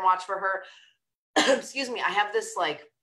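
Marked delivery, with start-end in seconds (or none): none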